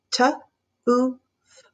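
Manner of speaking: T, oo, f each spaced; three sounds of tooth apart. Shown as a demonstration. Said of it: "Say it sound by sound and count".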